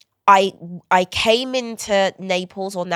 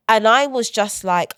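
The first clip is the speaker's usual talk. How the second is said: normal talking voice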